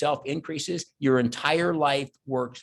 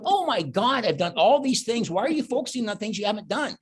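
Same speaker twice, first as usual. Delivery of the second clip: inner voice exclaiming; 8 semitones higher than usual. Retold or imitated. Inner voice realizing.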